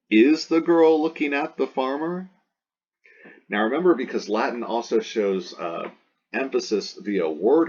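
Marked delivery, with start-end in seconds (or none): 0.1-2.3 yes-no question, rising at the end; question tune. Shown as a demonstration. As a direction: ask it with the voice climbing high through "looking at the farmer". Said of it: "to a question?".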